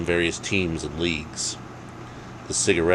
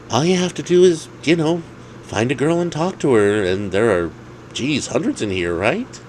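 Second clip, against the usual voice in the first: Alex's voice; 8 semitones higher than usual. Acted. Alex urging Dave to talk to a girl, eager higher voice. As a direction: push him on, all enthusiasm.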